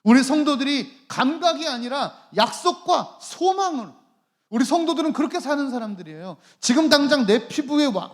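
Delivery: forceful, urgent pulpit oratory; impassioned preaching. Real, not acted. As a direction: preach at full force, piling up the phrases.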